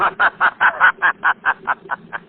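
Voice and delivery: loud, high madman's cackle; maniacal laugh. Acted. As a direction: laugh like a killer who has lost his mind. Unmasked killer gloats.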